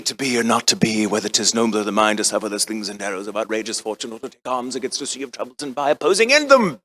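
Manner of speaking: hushed, low soliloquy, rising at the end; Shakespearean recitation. Acted. Translating his blunder as Hamlet, solemn recitation. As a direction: recite solemnly, then build to the punchline. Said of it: "I would give you Shakespeare".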